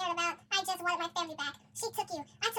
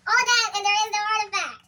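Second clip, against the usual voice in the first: outraged disbelief; 6 semitones higher, 11 dB louder than usual. Real, not acted. Shocked at plot twist.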